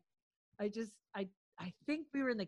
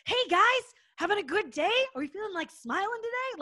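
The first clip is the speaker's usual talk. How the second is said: much higher and louder, pushy chirpy boss greeting; mock the manager's forced morning cheer; hammed-up obnoxious boss enthusiasm; forceful fake-cheery manager; 11 semitones higher, 6 dB louder, faster than usual. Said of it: "Like, really forceful and shitty".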